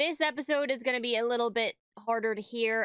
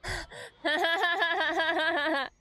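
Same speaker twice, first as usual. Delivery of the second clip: delighted laugh; 5 semitones higher than usual. Real, not acted. Laughing at the scene.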